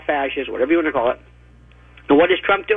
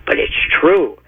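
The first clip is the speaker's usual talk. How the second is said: ordinary talk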